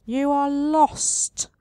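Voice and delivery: the tree face telling the traveler he is lost; wistful tree voice. Acted. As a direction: draw it out mournfully. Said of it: "declares wistfully".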